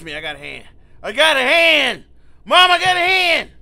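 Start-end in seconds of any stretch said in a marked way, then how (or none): 1.0-2.0 loud, slow, high yell; drawn-out victory yell; triumphant shout. Real, not acted. Repeats line, then yells it. 2.4-3.5 high, loud call across the house; calling to mom. Pretend.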